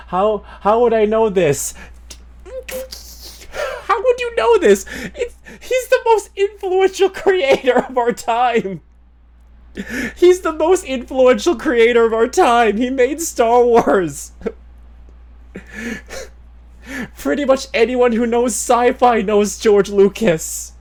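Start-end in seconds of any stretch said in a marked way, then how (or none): 3.8-12.8 lightly voiced George Lucas, higher and a little laughing; a hint of George Lucas, pitched up; a light George Lucas impression. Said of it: "The George Lucas voice is back again". 12.8-20.7 George Lucas voice. Retold or imitated. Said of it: "The George Lucas voice is back again".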